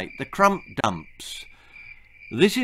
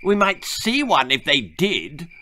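put-on snide boy; 5 semitones higher, 3 dB louder than usual. Percy continues sniping.

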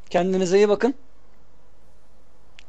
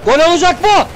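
excited; 6 semitones higher, 4 dB louder than usual. Real, not acted. Excited gaming call.

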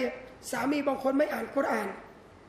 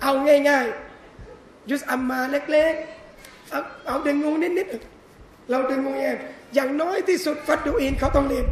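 normal talking voice

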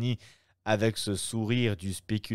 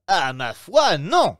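loud, higher refusal; crying out; 7 semitones higher, 9 dB louder, slower than usual. Acted. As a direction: throw out a flat no. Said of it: "s'écria Albert". (Albert refuse en s'écriant, plus fort)

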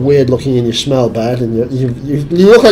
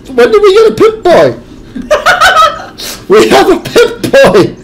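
very high, loud delighted shriek with laughter; excited squeal; 6 dB louder than usual. Real, not acted. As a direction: squeal with surprised joy. Finds a Pip-Boy, thrilled.

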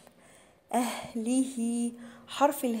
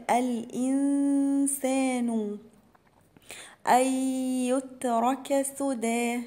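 slow, measured recitation of أولى لك فأولى; Quran recitation; 2 semitones higher, slower than usual. Shown as a demonstration. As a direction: recite each letter with its full length. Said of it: "فيها إدغام بغنة". (تلاوة مرتلة ببطء)

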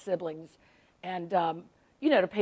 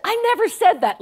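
higher, louder mock protest; indignant denial; 13 semitones higher, 10 dB louder than usual. Sister flatly denying what she said, mock indignant and loud. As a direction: fire back a hammed-up denial.

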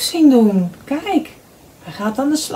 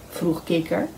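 usual speaking voice

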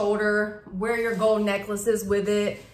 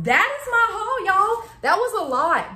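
cheery wrap-up; 10 semitones higher, 4 dB louder than usual. Real, not acted. Cheerful sign-off to viewers.

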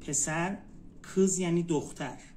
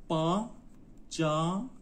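باجاناک slowly as با، جا، ناک; slow teaching demo of باجاناک, split into syllables; much slower than usual. Shown as a demonstration. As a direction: sound out باجاناک in three separated beats. Teacher breaks word into syllables.

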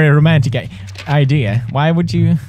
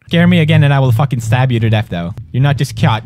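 ordinary delivery

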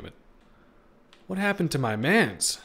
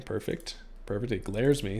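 ordinary talk, nothing marked

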